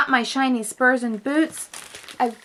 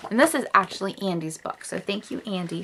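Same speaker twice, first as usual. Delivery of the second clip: ordinary delivery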